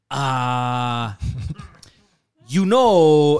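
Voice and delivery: fake Spanish accent. Mocking Paul's fake accent with Spanish speakers. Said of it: "If you just talk in the accent".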